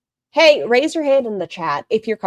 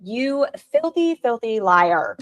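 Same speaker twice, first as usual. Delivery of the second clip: slow, relished condemnation starting high; drawn-out accusation; 5 semitones higher, slower than usual. Real, not acted. Streamer calling out the woman in the video, scornfully slow. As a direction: draw out each word of the accusation.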